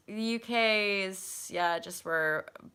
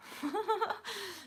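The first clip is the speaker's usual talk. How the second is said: high laugh; 13 semitones higher, 3 dB quieter than usual. Real, not acted. Laughs at own accent.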